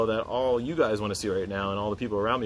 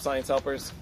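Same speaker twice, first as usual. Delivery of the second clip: ordinary delivery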